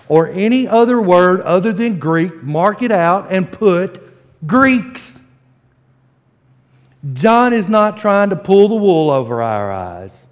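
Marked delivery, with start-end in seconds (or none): 4.5-5.2 "Greeks" called out higher; emphatic Greeks. Acted. Preacher telling church to mark their Bibles, emphatic "Greeks". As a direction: punch "Greeks" up high as the correction.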